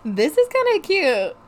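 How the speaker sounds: high, bright-eyed self-approval; a sudden pleased lift on okay; pleased with herself. Retold or imitated. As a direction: talk yourself into liking it. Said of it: "I was feeling myself".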